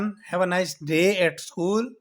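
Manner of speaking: very slow and even; slow model sentence. Shown as a demonstration. Teacher voicing the mother seeing her son off, slow. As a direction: spell out the farewell. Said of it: "Bye son".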